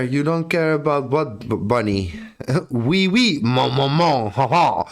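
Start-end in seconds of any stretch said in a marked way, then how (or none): 2.7-4.8 hammed-up French accent; mock French. Mock French accent for chat. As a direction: play the cheesy Frenchman for chat. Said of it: "That's my best French thing I can do".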